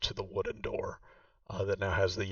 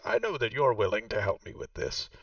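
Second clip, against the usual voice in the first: game master voicing Vaku, noticeably higher; Vaku's voice; 5 semitones higher than usual. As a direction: play the proud host showing her old home. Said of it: "She says".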